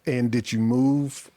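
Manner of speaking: ordinary talk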